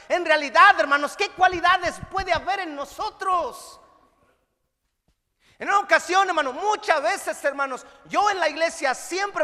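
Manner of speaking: fervent preacher calling to the brothers; preaching. Acted. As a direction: preach it out to the hall. Preacher addressing congregation.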